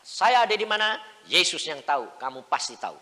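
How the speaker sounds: loud, high plea to God; exclaiming to God in a sermon story. Retold or imitated. Preacher voicing himself to God.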